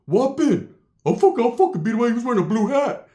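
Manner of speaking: high, hyped-up gangster challenge; Ricky. Retold or imitated. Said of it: "And Ricky's walking".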